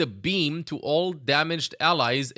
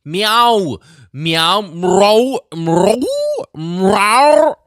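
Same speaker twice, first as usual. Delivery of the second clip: loud, yowling meows like a cat; 5 semitones higher, 10 dB louder, much slower than usual. Pretend. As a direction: yowl like an angry alley cat. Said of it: "I'll try my hardest to read the developer comments".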